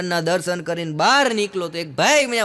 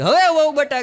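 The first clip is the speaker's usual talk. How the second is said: mother-in-law's demand; 8 semitones higher, 7 dB louder than usual. Retold or imitated. Mother-in-law demanding after paying.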